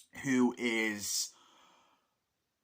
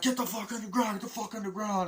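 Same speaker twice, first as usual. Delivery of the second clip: high-pitched, frantic commanding yell; re-enacted panicky army command; acted soldier's order; 10 semitones higher, faster than usual. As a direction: order them down in a raised, urgent voice.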